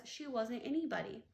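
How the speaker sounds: plain reading voice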